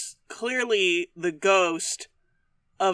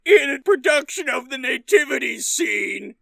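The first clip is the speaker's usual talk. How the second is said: higher, whiny shouted rant; whiny ghost rant; 4 semitones higher than usual. Pretend. [name] telling the cowboy why he's angry, whiny shouting rant. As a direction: complain like a cranky man-child. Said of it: "[name] sounds like Adam Sandler".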